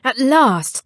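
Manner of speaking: ordinary delivery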